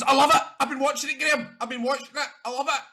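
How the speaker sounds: high, gushing mum impression; mum's excited voice. Son retelling his mum gushing about the show. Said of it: "She goes".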